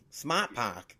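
commercial catchphrase in a mock Boston accent; light Boston accent imitation. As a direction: drop the r's like a put-on Boston accent. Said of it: "not specifically from that new commercial".

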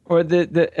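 usual speaking voice

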